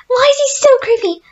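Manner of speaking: high and loud panicked question; freaked out. Real, not acted. Gamer spots the chef, high scared squeal. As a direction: squeal it in fright.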